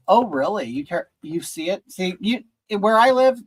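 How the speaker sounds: usual speaking voice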